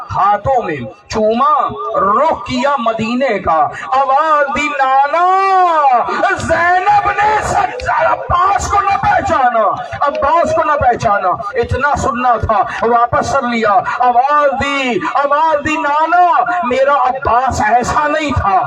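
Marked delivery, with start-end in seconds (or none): 3.9-11.3 crying out to her grandfather; keening lament. Acted. Zainab cries to grandfather. 14.0-18.6 mourning wail; keening lament. Acted. Zainab cries to grandfather.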